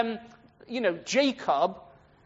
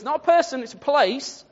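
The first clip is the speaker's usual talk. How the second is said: higher, louder acted correction; emphatic correction; 7 semitones higher, 6 dB louder than usual. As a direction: set the confused listener straight. Acts out prophet correcting the reader.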